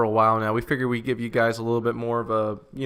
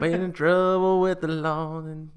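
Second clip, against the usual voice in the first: lightly hammed-up Southern drawl, a bit higher; mock Southern drawl; 6 semitones higher than usual. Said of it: "good old Southern boy".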